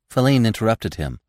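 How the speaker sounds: ordinary delivery, nothing marked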